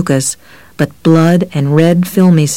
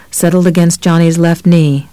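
plain reading voice

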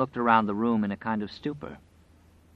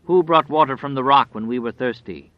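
loud, lightly voiced catechism question; wolf's questioning voice; 3 semitones higher, 7 dB louder than usual. A narrator's reading. Grinning wolf questioning itself in two voices, loud questioning. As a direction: demand it like a leader of a litany. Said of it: "began to speak in two voices".

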